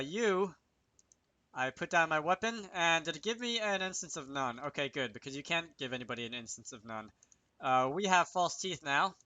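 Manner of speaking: normal talking voice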